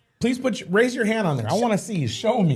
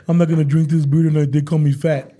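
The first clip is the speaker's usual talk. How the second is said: louder, hammed-up wounded pout; mock sulk; 4 semitones lower, 6 dB louder than usual. Boyfriend mimicking girlfriend's hurt sulk over beer, louder and pouty. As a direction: play her hurt, whining it out. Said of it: "that's how sensitive she was".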